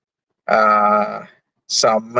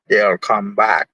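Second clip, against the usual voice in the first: ordinary talk, nothing marked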